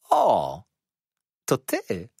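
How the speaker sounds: feigned surprise call, lightly acted. A narrator's reading. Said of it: "zawołała".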